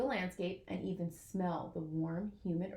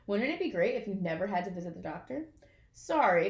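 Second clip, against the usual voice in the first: plain reading voice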